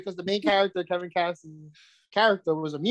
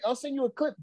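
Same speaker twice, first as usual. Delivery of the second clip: ordinary talk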